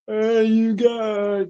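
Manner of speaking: slow, high drawn-out call; Sloth voice. Retold or imitated. Answering a viewer, slow high Sloth impression. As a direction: stretch it out like Sloth. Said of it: "is what Sloth says in the Goonies".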